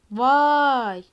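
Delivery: a loud startled "vay"; surprised shout. Real, not acted. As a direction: blurt it out in shock. Sudden surprise in the game.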